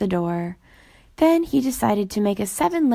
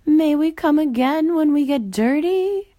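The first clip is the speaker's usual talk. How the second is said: a hint of a higher, shy ghost; timid ghost voice; 7 semitones higher, 3 dB louder than usual. A narrator's reading. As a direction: ask timidly like a little ghost.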